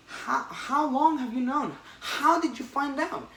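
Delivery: shocked Peter. Retold or imitated. Retelling the comic, Peter shocked that Norman knew. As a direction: gasp it out in disbelief.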